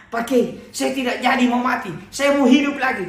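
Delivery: high, loud, laughing with relief; excited relief. Acted. Man rushes back to tell the kiai he wants to live, laughing and loud. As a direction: blurt it out, overjoyed to live.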